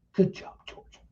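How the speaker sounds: unvoiced whisper under the breath; whispered to herself. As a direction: breathe it privately to yourself. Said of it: "to herself".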